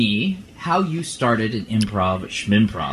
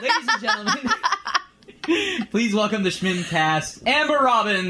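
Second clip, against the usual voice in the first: announcer voice; 9 semitones higher than usual. Acted. Podcast host introduces guest.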